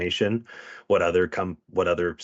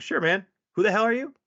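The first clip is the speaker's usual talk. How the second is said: puzzled cop; 14 semitones higher, 4 dB louder, faster than usual. Retold or imitated. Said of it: "We're like".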